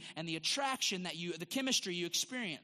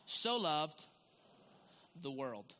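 short phrases with long pauses for the congregation to echo; call-and-repeat reading; slower than usual. Real, not acted. Pastor asks the church to repeat after him, measured phrases. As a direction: lead them through the verse piece by piece. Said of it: "will you repeat after that".

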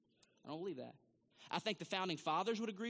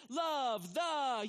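church call-out; 5 semitones higher, 6 dB louder than usual. Retold or imitated. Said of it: "I were to say".

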